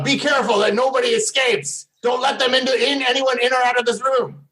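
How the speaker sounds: lightly disguised hobgoblin order; barking orders in a disguised voice. Acted. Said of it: "he's trying to disguise his voice".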